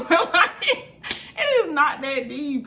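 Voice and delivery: loud, high burst of laughter into words; laughing. Real, not acted. Storyteller amused by the silly argument, laughing. Laughing at her own retold comeback.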